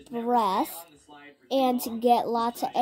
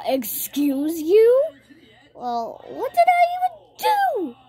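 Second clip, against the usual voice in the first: sassy comeback; 9 semitones higher, 4 dB louder than usual. Retold or imitated. Kid talking back to teacher.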